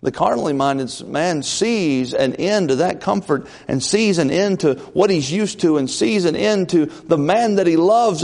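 usual speaking voice

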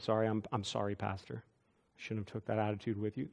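mild tearful apology. Retold or imitated.

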